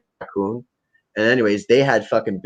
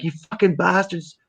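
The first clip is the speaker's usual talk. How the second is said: higher, exasperated scolding of the cats; pitched-up fed-up cat owner; mock scolding cats; 8 semitones higher than usual. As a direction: curse them out in a pitched-up voice. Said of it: "I'm like".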